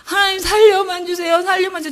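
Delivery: sobbing desperate prayer; frantic crying out. Retold or imitated. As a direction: cry out like you've lost your mind. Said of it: "정신 나간 처럼 제가 부르짖었어요".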